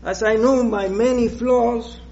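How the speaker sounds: ordinary delivery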